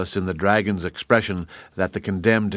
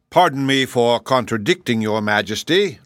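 ordinary delivery

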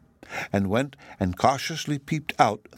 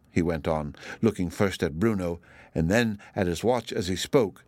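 ordinary delivery, nothing marked

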